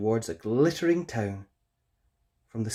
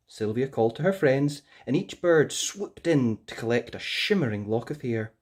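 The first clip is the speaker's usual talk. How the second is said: plain reading voice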